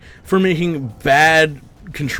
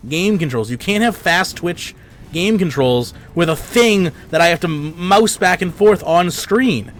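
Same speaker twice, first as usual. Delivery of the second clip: angry rant; 5 semitones higher than usual. Real, not acted. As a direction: vent furiously at the developers. Ranting at game developer.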